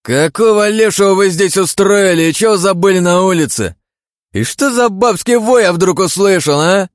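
loud, high rude bellow. Acted. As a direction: yell it like a spoiled lord. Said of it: "Продолжил горланить тот".

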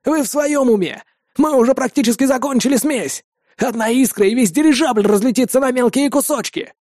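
high, agitated protest; alarmed exclamation. A narrator's reading. Engineer warning that the airship could explode, alarmed exclamation. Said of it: "воскликнул один из инженеров".

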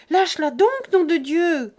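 loud, high, desperate yell; shouting. A narrator's reading. Jean running toward the dragged girl, loud frantic shout. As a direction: roar it while running after her. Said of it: "continuait à crier Jean".

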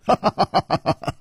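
staccato ha-ha chuckle; acted laughter. A narrator's reading. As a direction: laugh in an even run before speaking. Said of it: "楚留香笑着说". (哈哈笑)

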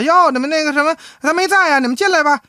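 high, sing-song housewife welcome; wife opening the door. Retold or imitated. Wife greets husband's colleagues at the door, high and chirpy. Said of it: "正好他媳妇儿开门了".

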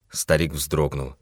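plain reading voice

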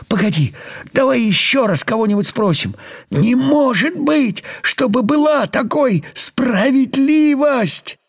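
high, urgent insisting; insistent ant. Acted. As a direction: press hard for another judge. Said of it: "настойчиво попросил муравей". (настойчиво и высоко)

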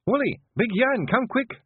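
high, urgent Scots yell. A narrator's reading. Wife calling the men to come, urgent yell. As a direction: call out in alarm for help. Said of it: "she yelled".

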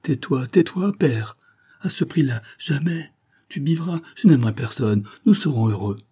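high-pitched, urgent protest; acted alarm, voice lifted high; horrified pleading. A narrator's reading. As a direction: plead in dread for silence. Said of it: "Elle se jeta dans ses bras avec épouvante".

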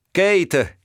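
high, loud call across the room; a sudden loud cry for help; shouted name. A narrator's reading. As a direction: shout for Kate urgently. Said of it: "gridò Valcourt".

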